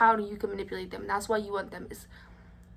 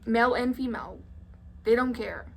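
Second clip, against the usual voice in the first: ordinary delivery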